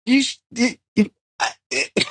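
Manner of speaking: high, stammering disbelief; sputter, then push the question up high; mock exasperation. Speaker challenging a validation-seeking cook, exasperated. Acted exasperation at needy boyfriend.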